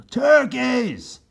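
high loud alarm; shout. Acted. Captain Bill shouting to his men, alarmed. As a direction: call the men's eyes over. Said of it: "shouted Captain Bill".

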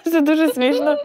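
said through laughter, high; cracking up at the joke; laughing. Real, not acted. Reacts to the joke. (крізь сміх)

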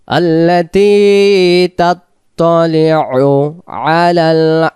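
slow chanted recitation; Quran recitation. Shown as a demonstration. Teacher chanting the verse for students to follow. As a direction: hold each vowel in a smooth chant.

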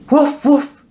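very high joyful woofs; cheerful dog barking; happy barks. A narrator's reading. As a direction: bark for joy. Dog cheers star reaching home.

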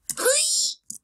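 shrill surprised squeak; high squeaky gasp. Real, not acted. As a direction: squeak in alarm. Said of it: "Why did I make that noise?".

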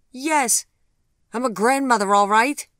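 usual speaking voice

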